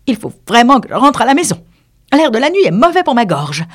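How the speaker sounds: put-on high, loud, fussy old magpie. A narrator's reading. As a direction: rattle it off high like an old bird. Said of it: "Une vieille pie".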